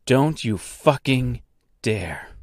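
slow, word-by-word threat; controlled angry ultimatum; seething warning. Acted. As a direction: measure out each word, dropping on the last. Repeats threat to gatekeeper.

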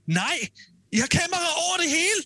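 high-pitched exasperated outburst. Real, not acted. Ranting to co-host about phones on the show, exasperated. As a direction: protest in a high, worked-up voice.

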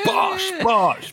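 high mock-triumphant shout. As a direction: yell it like a victory cry. Acting out James's triumph.

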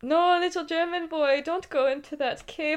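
high-pitched playful cry of warning; put-on, high melodramatic plea; mock alarmed warning. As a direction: warn a child away in hammed-up alarm. Mock warning to a German boy.

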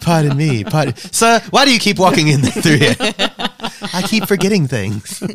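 mock polite apology. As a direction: ham up an apologetic squeeze past. Acting squeezing past people.

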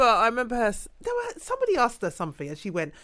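usual speaking voice

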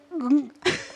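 high nervous squeal of a laugh. Retold or imitated. Fan squealing when her idol says hello. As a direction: let out a starstruck squeak. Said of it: "And I went".

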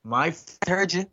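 high, drawn-out indignant retort; imitated accusation. Drag queen confronting cinema lads with witness, imitated accusation. Friend insists, first take before emphasis.

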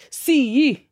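punchy Scots with the first word stressed; Scottish accent. Shown as a demonstration. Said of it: "a Scottish accent might lead".

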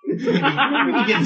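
words stretched and broken by laughing; laughing tease. Real, not acted. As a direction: tease while cracking up. Teasing friend while cracking up.

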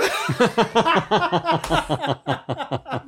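loud, long burst of laughing; hearty laughter. Real, not acted. Storyteller laughing at her own punchline, loud laughter. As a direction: crack up at your own story.

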